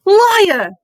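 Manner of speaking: sharp high shout. A narrator's reading. Harry shouting back at Voldemort, a loud high yell. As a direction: fling the word out, loud and high. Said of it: "Harry shouted suddenly".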